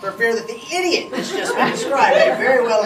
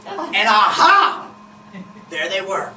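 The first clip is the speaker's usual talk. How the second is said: triumphant aha; 8 semitones higher, 7 dB louder, slower than usual. Retold or imitated. Husband triumphant discovery, laughing.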